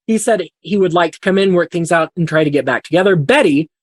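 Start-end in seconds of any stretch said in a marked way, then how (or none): none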